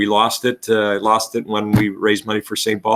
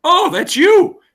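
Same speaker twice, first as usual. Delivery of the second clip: mock surprise; 6 dB louder, slower than usual. Coach's own surprised reply retold.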